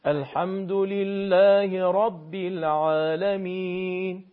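slow chanted الحمد لله رب العالمین with a light ع; Quranic recitation. Shown as a demonstration. Teacher modelling for the caller: slow recitation with a light ع. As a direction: draw out العالمین gently. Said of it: "عینتون یه مقدار کمتر".

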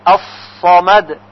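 الصمد with a thick, heavy ص; heavy sad. Shown as a demonstration. Teacher showing the heavy ص in الصمد. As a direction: say الصمد with a full ص. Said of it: "با صاد باید تلفظ".

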